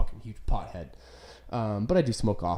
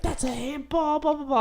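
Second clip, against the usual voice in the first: mock parent screaming. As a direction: mimic ranting parents in a high squeal. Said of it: "they'd scream at me and be like".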